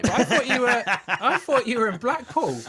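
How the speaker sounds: high, loud, incredulous laugh-talk; loud amused protest; laughing disbelief. Real, not acted. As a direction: tease him through laughter, voice lifted. Teasing astonishment at where he is.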